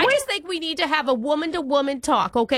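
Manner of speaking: hammed-up Long Island-Boston-Minnesota mom accent; horrible jumbled accent. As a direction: play a chatty older girlfriend in a bad regional accent mash-up. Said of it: "doing a horrible accent".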